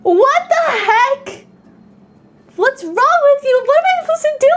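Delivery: exaggerated shocked what the heck; playfully scandalized, voice high; mock outrage. Real, not acted.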